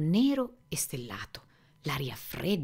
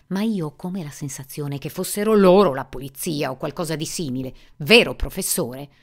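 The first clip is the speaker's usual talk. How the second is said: angry; 4 semitones higher, 6 dB louder than usual. Acted. Susan raging at ex-husband.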